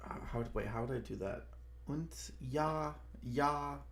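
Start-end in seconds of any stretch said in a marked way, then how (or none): 1.9-3.8 trying out a German accent, higher-pitched; tentative put-on German, pitched up. Shown as a demonstration. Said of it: "Or, like, a German one".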